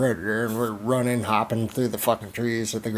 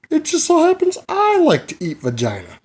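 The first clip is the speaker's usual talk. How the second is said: loud, very high-pitched punchline; hammed-up giddy high voice; mock excitement; 7 dB louder than usual. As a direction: squeal it like a thrilling discovery. Comic punchline of feigned coincidence.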